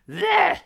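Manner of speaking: a very high, loud squeal of a newborn's nonsense sound; baby babble. Pretend. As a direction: blurt it out like a baby's first word. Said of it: "something like a baby would make".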